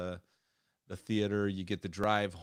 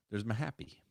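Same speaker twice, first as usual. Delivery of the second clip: ordinary talk, nothing marked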